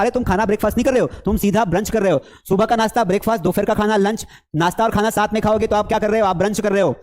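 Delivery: ordinary delivery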